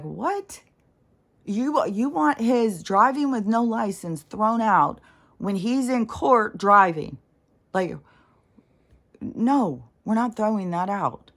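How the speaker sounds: a judge who can't believe the request; incredulous judge. Retold or imitated. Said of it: "And the judge is like".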